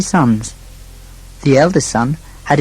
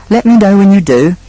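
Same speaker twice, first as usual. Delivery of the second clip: raised, louder parting call; 6 semitones higher, 6 dB louder than usual. A narrator's reading. Second son to his brother, calling out. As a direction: call it out as you leave. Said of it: "called the second son".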